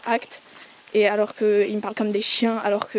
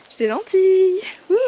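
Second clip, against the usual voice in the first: playful excited; 8 semitones higher, 5 dB louder than usual. Real, not acted. Playful boast.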